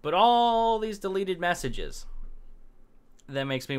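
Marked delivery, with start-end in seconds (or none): 0.0-2.0 slow, stretched, sardonic; drawn-out mock disbelief. Vlogger mocking the site's deleted comments, slow and sardonic. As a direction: drag it out to mock them.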